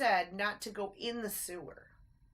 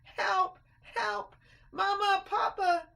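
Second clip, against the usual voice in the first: high, loud desperate cries; calling for help; 13 semitones higher, 9 dB louder, slower than usual. A narrator's reading. Christopher lost in the sewer, screaming for his parents.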